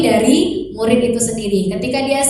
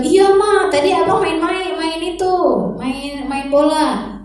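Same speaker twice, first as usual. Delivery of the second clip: put-on higher little boy answering; child's voice; 5 semitones higher than usual. Son answers mother, higher child voice. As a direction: play the son explaining himself.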